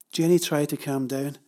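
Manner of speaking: usual speaking voice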